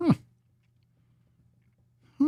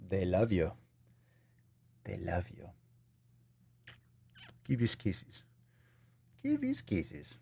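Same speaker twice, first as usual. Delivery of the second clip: put-on soft, gentle baby talk; cooing to pet; 2 semitones lower, 8 dB quieter than usual. Streamer shows Papa the fam, softly cooing. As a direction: coo quietly, coaxing for attention.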